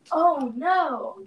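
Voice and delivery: sudden gasp, then high "oh no"; alarmed gasp. Real, not acted. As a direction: caught off guard, gasp and worry. Realizes charging cord missing.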